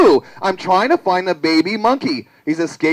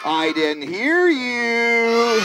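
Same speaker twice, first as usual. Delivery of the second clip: slow, drawn-out tease; calling out; 3 semitones higher, much slower than usual. Acted. Asking to show the dance, teasing drawn-out call. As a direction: stretch the last word, fishing for a reply. Teasing audience for louder answer.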